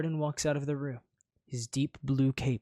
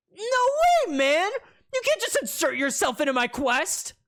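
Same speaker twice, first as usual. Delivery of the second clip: laughing protest; 8 dB louder than usual. Acted.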